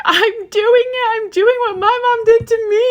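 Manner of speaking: crying to husband about repeating her mother, very high and slow. Retold or imitated. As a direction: let it break like a tearful confession. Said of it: "I even cried to my husband".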